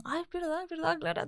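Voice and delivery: lightly acted moment of recognition; mock realisation. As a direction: act out the penny dropping, softly and high. Acting out a sudden realisation.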